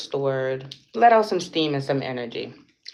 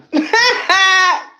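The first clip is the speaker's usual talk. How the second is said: high, loud burst of laughter; 10 dB louder than usual. Real, not acted. Laughing at her own credit joke, a loud high cackle. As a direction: crack up at your own joke.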